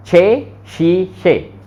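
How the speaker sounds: छह शीशे drawn out slowly, syllable by syllable; slow German pronunciation. Shown as a demonstration. Teacher slowly repeats छह शीशे for class. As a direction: stretch out छह शीशे slowly for students. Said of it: "उसको बोलते हैं छह शीशे".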